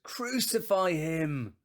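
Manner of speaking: slow, high, loud cry; crowd shout. Acted. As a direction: shout it as the whole mob, drawing it out. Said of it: "And they all answered".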